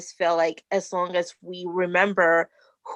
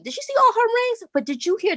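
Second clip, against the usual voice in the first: high, fast gossiping aside; gossipy onlooker; 12 semitones higher, faster than usual. Pretend. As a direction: gasp it out like a nosy guest.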